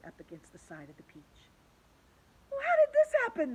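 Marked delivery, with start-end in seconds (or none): 2.5-3.5 very high, loud wail; panicked cry. Acted. The creatures wail to each other, high and panicked. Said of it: "they cried".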